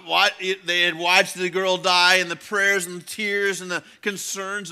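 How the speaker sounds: loud, high, breathless-with-wonder storytelling; impassioned preaching. Real, not acted. Preacher pictures angels watching the girl rise, impassioned. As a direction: build the scene with full-voiced awe. Preaches climax at full force.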